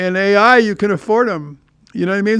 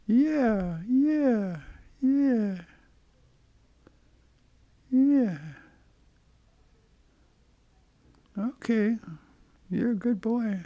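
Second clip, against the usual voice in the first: pet talk; 3 semitones higher, 12 dB quieter, much slower than usual. Real, not acted. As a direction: coo to your kitty softly. Cooing to the cat.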